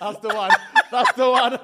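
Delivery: chuckling approval; laughing. Real, not acted. Laughing at favourite joke.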